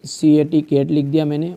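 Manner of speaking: ordinary talk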